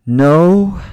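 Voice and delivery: loud, high, drawn-out command; yell. Acted. Narrator halting a boy crawling away, yelling. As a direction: yell to stop him in his tracks. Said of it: "I yelled".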